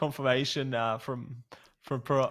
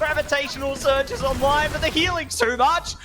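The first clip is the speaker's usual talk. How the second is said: high, loud, rising commentary call; excited play-by-play; 9 semitones higher, 4 dB louder than usual. Real, not acted. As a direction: shout the final push. Casting the final push.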